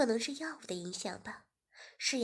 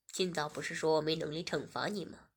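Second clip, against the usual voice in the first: much lower, smug man; teasing male voice; 7 semitones lower than usual. Acted. Host teasing Shiya in the study, much lower. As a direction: tease her low and sly.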